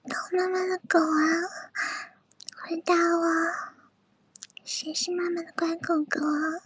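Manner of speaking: soft teasing whisper; breathy whisper close to the mic; ASMR whisper. Acted.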